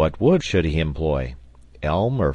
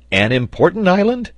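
high, loud, excited query; eager question; 12 semitones higher, 6 dB louder than usual. A narrator's reading. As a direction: jump in with rising excitement. Pencroft's eager question about the island.

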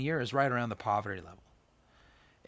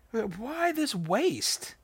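much higher, very slow, stretched-out scoff; mock indignant disciples; 10 semitones higher, much slower than usual. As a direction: drag out the disciples' outrage. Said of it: "the disciples are indignant".

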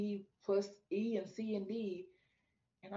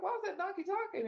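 puzzled amazement; 12 semitones higher, faster than usual. Retold or imitated. Her puzzled question about donkey.

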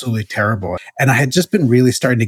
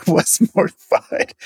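laughing; 8 semitones higher, slower than usual. Real, not acted. Laughing while echoing Ben's line.

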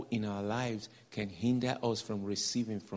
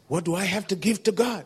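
higher, louder exasperated question; exasperated demand; 7 semitones higher, 7 dB louder than usual. Retold or imitated. Preacher voicing the angry man, loud exasperated question.

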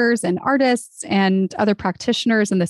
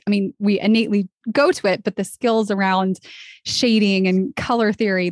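normal talking voice

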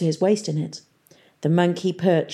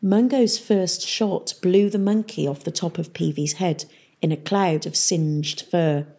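usual speaking voice

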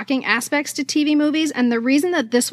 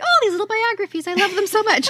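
put-on childlike; 9 semitones higher than usual. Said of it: "I'm like".

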